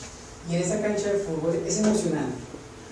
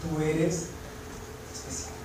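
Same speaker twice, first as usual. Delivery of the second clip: much softer, fading to almost nothing; tender whisper; 3 semitones lower, 10 dB quieter than usual. Shown as a demonstration. God speaking tenderly to the listener, soft and hushed. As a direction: breathe it gently, close. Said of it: "Y te dice".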